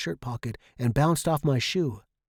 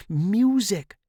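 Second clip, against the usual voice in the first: MUSIC higher and drawn out; stress on music; 7 semitones higher, 3 dB louder than usual. A narrator's reading. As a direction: lift the word music emphatically. Contrasts imagination with music.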